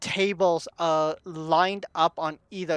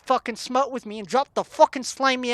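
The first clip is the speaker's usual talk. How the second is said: angry demand; 6 semitones higher, 4 dB louder than usual. Acted. Barkeep orders Fred to stop.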